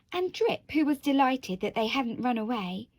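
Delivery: plain reading voice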